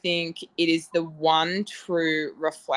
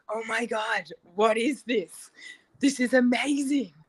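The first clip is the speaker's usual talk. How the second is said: mock amazed gush; 5 semitones higher than usual. Retelling her reaction to the wine.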